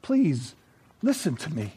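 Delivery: soft, cracking plea; a hint of tears, quietly begging; voice breaking. A narrator's reading. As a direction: let the voice break as she begs. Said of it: "And her voice broke".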